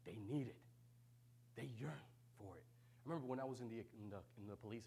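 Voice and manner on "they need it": normal talking voice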